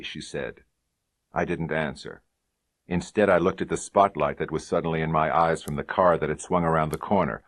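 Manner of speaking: ordinary delivery, nothing marked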